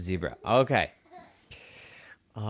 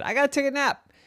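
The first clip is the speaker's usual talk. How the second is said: higher zebra voice; sleepy zebra line; much faster than usual. A narrator's reading. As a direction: announce the nap like the zebra.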